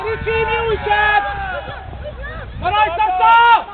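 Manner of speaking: long sideline yell; drawn-out shout. Real, not acted. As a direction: stretch the words so they carry. Coach directing players mid-play.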